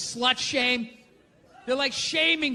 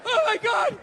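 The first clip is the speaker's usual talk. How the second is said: frantic dieter; 9 semitones higher than usual. Pretend. Starving dieter turning down cake, high frantic shouting. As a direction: refuse the cake like your life depends on it.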